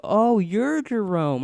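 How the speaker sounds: high, loud, drawn-out mock chorus; TV-show recognition. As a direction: imitate office staff dramatically recognizing someone. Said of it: "like, a T V show".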